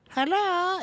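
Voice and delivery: squeaky high little worm greeting; chirpy worm saying hello; worm popping out. Pretend. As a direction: pop up like a cheery apple worm. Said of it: "he just comes out and goes".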